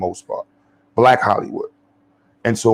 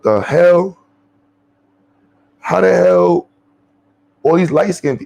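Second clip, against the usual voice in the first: high, louder astonished exclamation; retold shock; 7 semitones higher, 3 dB louder than usual. Visitor retelling his shock at light-skinned people, astonished. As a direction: act out the baffled double take.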